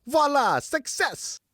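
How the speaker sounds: put-on boy cheering his success; beaming cry. A narrator's reading. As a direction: crow with delight. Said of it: "Geoffroy beamed".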